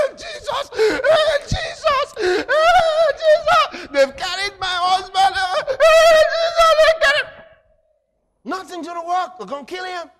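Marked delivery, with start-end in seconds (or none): 0.1-7.3 high, loud wailing cries of Jesus; frantic mock lament, much higher and louder; acted panicked wailing. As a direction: wail like a wife whose husband was taken. Wife wails, husband taken away.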